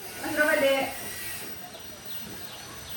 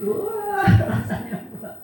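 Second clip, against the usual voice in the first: laughing; 13 semitones higher than usual. Real, not acted. Mother laughs retelling joy.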